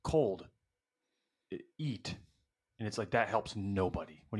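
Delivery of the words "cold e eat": caveman one-syllable words. Shown as a demonstration. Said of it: "you just say, like".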